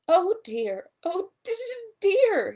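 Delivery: high, loud frightened stammer. A narrator's reading. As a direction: stutter in fright as he's yanked upward. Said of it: "he stammered".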